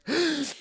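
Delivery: sharp high inhale; deep sniffing gasp. Shown as a demonstration. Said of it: "take a really nice deep breath".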